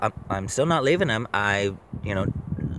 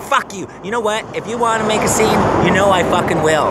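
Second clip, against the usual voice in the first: angry retort; 8 semitones higher, 4 dB louder than usual. Retold or imitated.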